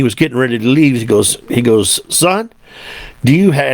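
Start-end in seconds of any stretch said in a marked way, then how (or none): 2.1-3.7 Southern voice. Retold or imitated. Deputy chief sidles up to the trucker as he leaves, attempted Southern voice. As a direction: imitate a Southern lawman, pitched up. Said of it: "I can't do a Southern voice".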